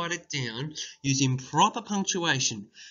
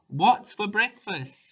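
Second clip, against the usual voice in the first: put-on higher, slow eager child; slow, high child asking; child's eager question; 7 semitones higher, slower than usual. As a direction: ask like a kid. Child voiced in story.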